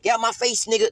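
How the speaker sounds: put-on high, shrill-edged little kid cussing out the parent. Five-year-old cussing at the parent in a high kid voice. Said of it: "running around the house like".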